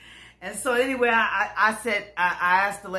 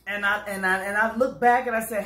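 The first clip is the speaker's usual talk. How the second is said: ordinary talk, nothing marked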